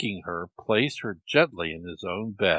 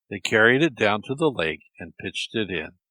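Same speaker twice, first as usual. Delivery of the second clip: plain reading voice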